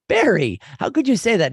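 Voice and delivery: high, loud "how could you say that"; mock outrage at a friend; shocked protest. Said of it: "I went".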